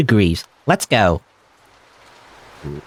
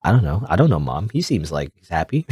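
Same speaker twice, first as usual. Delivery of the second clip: normal talking voice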